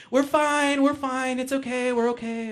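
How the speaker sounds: lightly camp voice calling up from the yard. Retold or imitated. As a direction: camp voice insisting all is fine. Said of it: "the gayest little voice".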